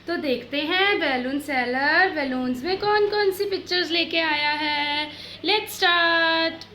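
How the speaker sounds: put-on loud, high, bright-eyed opening for children; big cheerful classroom hype; excited kids' teacher. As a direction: announce it like a show host for little kids.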